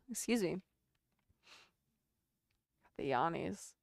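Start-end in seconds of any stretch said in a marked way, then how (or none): none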